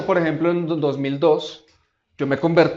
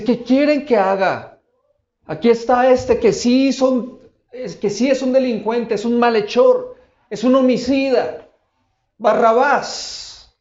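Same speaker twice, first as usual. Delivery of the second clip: raised, pleading voice to the crowd, higher and louder; Pilate calling out; 6 semitones higher, 7 dB louder than usual. Retold or imitated. Pilate asks the shouting crowd whom to release, raised and pleading. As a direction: call out to the mob, what should I do. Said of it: "Y él dice".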